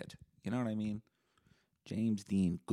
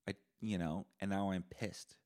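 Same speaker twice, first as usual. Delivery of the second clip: ordinary delivery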